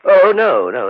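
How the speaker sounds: sudden high loud no; jolted out of his thoughts; startled denial. Acted. Host startled by his guest's question, a jumpy high no. Startled when asked about the flask.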